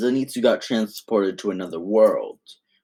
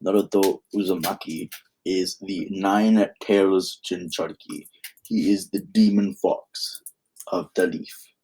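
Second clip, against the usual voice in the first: ordinary delivery, nothing marked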